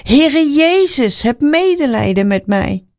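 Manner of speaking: high, stretched, urgent plea in a storyteller's voice. A narrator's reading. Blind man ignores the scolding crowd, calling insistently for mercy. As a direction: draw the name out high, then plead. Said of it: "Hij riep nog veel harder".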